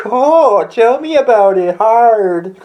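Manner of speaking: high, loud campy gush; mock flamboyant sigh; camp driver.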